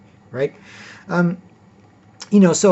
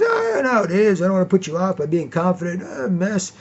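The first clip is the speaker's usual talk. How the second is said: high, mocking falsely modest voice; fake humble guy; 4 semitones higher than usual. Coworker fishing for sympathy by acting modest.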